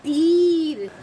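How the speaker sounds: तीर loud, with a long held ee. Shown as a demonstration. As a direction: stretch the ee in तीर. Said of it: "उच्चारण कैसे करते हैं वो देख लेते".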